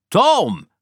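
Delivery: high, loud call across the house. Acted. Aunt Polly searching for Tom, loud high call.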